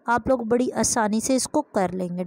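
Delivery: normal talking voice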